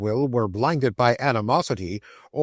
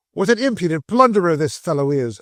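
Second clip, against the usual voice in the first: indignant outburst; 5 semitones higher, 4 dB louder than usual. Acted.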